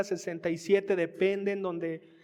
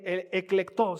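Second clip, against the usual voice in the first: ordinary talk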